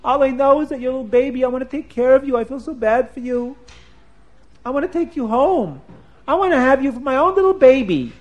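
high, loud doting coo; cooing to a baby. Acted. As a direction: dote on the baby like a new mother.

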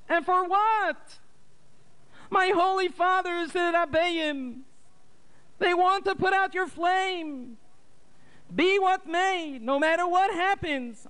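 very high mourning mother's voice; mother cries to her late husband, high and lamenting; bitter lament. A narrator's reading. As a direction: wail over her son's arrest. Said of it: "And she cried bitterly".

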